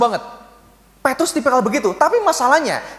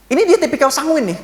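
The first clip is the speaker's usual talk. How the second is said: higher, bright-eyed excited telling; playful animated sermon aside; lively sanguine type; 7 semitones higher than usual. Acted. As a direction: bubble over like the life of the party.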